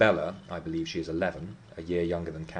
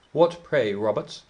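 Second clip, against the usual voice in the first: louder, higher call from the doorway; hallooing; 4 semitones higher, 5 dB louder, slower than usual. A narrator's reading. Linton calling to his servant from the entrance, loud halloo. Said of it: "hallooed Linton".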